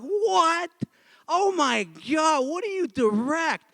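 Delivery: high, drawn-out incredulous outcry; mock disbelief. Friends hearing the director's skit choice, incredulous. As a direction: exaggerate the shock, pitch way up.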